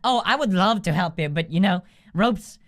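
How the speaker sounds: sugary mock-helpful voice; mock concern. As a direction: fake concern while scamming them. Mockingly offering help for money.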